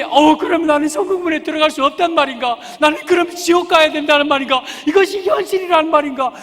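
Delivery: loud, high anguished questions; despairing lament. Acted. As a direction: cry out in disbelief. Rejected man despairing at gate. (높고 크게 절망하며 탄식하듯)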